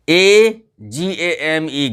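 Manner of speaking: each letter drawn out slowly; slow spelling. Shown as a demonstration. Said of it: "spelling out slowly". (अक्षर-अक्षर धीरे-धीरे बोलना)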